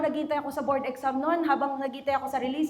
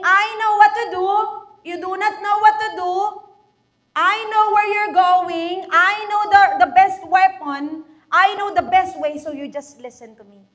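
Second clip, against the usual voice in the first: loud, emphatic voice of God; 4 semitones higher, 8 dB louder than usual. Acted. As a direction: proclaim it as God speaking. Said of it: "It is as if God is saying". (malakas na tinig ng Diyos)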